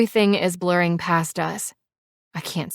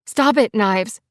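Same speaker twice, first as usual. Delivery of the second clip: higher, louder, urgent plea; raised voice; 5 semitones higher, 5 dB louder than usual. A narrator's reading. Passenger begging speeding biker to stop, raised voice. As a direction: raise your voice in protest. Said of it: "raise my voice".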